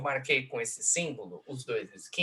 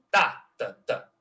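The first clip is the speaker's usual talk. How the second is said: first "tá" punched, two lighter after; dactyl beat; 3 dB louder, slower than usual. Shown as a demonstration. Lecturer recapping the dactyl, hard "tá" then two light. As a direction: beat "tá, tá, tá" strong-weak-weak. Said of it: "dátilo".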